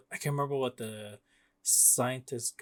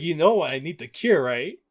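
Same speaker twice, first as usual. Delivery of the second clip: louder, higher mock-angry demand; losing it, retold; 4 semitones higher, 9 dB louder than usual. Peter confronting Doc Ock over the cure, mock outrage. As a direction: retell an angry plea, pushing the volume. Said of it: "he fucking loses it".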